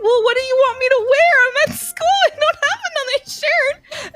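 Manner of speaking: very high, flustered protest; acted-out embarrassed panic; retold panic. Horrified retort to shirt's owner at school.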